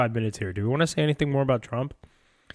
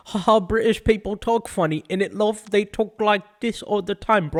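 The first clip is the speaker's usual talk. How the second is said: high-pitched, sing-along British caricature; mock British accent; 7 semitones higher than usual. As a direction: send up British speech in an exaggerated accent. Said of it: "You'll be like".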